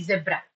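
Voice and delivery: "zebra" said as zeb-ra; short vowel in "zebra"; first syllable of "zebra" like web; British pronunciation. Shown as a demonstration. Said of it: "if you are following British English".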